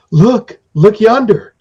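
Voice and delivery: high and slow, look yonder; a lightly voiced sprite showing the way; pointing little creature. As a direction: point it out with wide-eyed slowness. Little being points something out.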